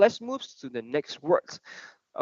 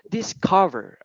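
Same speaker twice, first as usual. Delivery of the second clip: "Discover" with the stress on COV, pitched higher; word stress; 6 semitones higher, slower than usual. Shown as a demonstration. Teacher models the word for a student, middle syllable stressed. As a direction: lift and lean on the middle of "Discover". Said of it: "just remember on the word thread".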